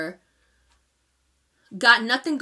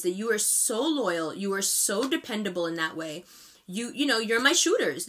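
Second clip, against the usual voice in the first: normal talking voice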